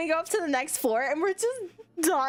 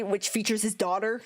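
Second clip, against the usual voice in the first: usual speaking voice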